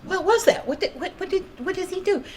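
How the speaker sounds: high, flustered retold alarm; startled. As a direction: blurt it out, rattled and high. Startled by booms.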